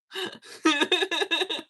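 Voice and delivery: high, loud peal of laughter; real helpless laughter. Real, not acted. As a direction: crack up at your own mishap. Laughs at her own marker mishap.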